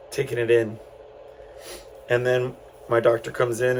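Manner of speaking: ordinary delivery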